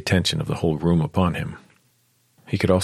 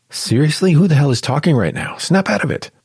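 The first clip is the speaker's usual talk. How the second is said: high, loud exasperated inner outburst; self-scolding; 9 semitones higher, 5 dB louder than usual. Acted. Clara scolding herself inside, exasperated. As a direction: snap at yourself to get it together.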